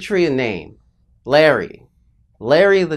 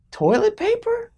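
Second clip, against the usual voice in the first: put-on very high, aghast child's squeak; traumatized disbelief. Child echoing dad's careless answer, acted traumatized disbelief. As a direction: repeat it in horrified disbelief, way up high. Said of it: "I was traumatized".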